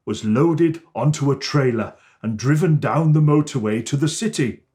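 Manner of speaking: ordinary delivery, nothing marked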